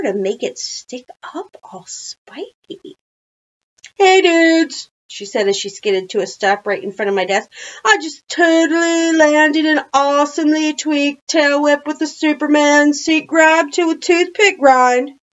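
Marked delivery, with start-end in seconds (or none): 4.0-4.9 high, loud biker-dude hail; loud greeting. A narrator's reading. Trick-biker character makes her entrance. 7.8-15.2 high, loud stunt-rider brag; boastful trick biker. A narrator's reading.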